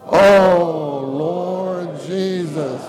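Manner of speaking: slow, drawn-out prayerful call; fervent group call to Jesus; calling on the Lord. Real, not acted. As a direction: stretch out the Oh, then Lord Jesus, slowly. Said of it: "let's call on the Lord together right now".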